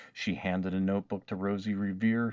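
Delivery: ordinary delivery, nothing marked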